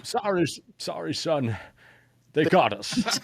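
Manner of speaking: put-on Scottish accent, pitched high, with a laugh at the end; Scottish-accented Connery voice for a gunner who was shot down; Sean Connery impression. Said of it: "That's a good accent".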